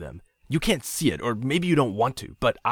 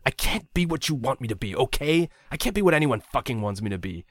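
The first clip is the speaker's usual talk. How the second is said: spat bitterly; 7 semitones higher, 4 dB louder than usual. A narrator's reading. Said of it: "he spat".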